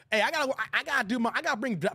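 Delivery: normal talking voice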